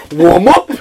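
imitated coach's loud query; mimicked incredulous demand. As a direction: throw it out as a sharp, loud challenge. Coach turns round, acted in anecdote.

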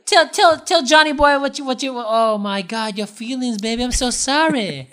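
high mock-consoling lover, easing down and slowing; Jon sweet-talking. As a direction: ham up the fake sympathy, drawing out the end. Voicing Jon sweet-talking the grieving queen.

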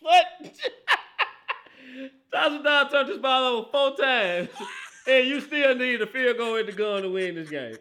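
loud, high exclamation through laughter; real incredulous laughing outburst; laughing disbelief. Real, not acted. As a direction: crack up while exclaiming in disbelief. Incredulous over the four interceptions.

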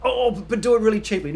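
high, loud tacked-on demand; mock screaming. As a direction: imitate someone yelling an afterthought. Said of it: "people screaming at your engineers saying".

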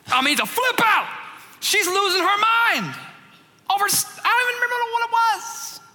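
worked-up retelling. Retold or imitated. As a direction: act out the meltdown in a high, frantic voice. Describing daughter's tantrum.

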